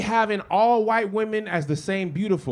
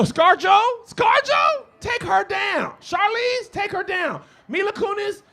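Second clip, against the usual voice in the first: excited high calls; 11 semitones higher, slower than usual. Real, not acted.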